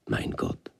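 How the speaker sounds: hushed breathless whisper; awed whisper. A narrator's reading. Moses overwhelmed before the bush, whispering. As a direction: breathe it out in awe.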